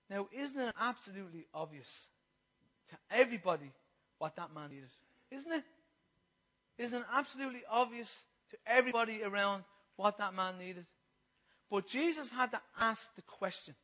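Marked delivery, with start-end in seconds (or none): none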